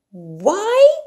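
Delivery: high, loud echo of the question; echoing surprise. Acted. Martha echoes Mary's cold question, astonished. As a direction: throw the word back, startled. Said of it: "echoed Martha".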